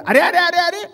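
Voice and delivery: high, loud yell of arre with a laugh; a driver blocked in traffic yelling out, high and loud; alarmed shout. Acted. Driver yelling at someone cutting in.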